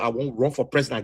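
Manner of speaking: ordinary delivery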